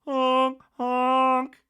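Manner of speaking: high, loud horn honks; blowhorn honk. Retold or imitated. Production's blowhorn imitated, loud honks. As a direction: blast it like a foghorn. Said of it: "put a blowhorn like".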